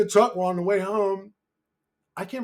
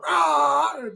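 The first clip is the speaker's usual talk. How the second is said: high honk made with the voice; imitated car horn; 10 semitones higher, 4 dB louder than usual. Dad still honking at red light, high vocal honk. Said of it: "This dude's still on his horn".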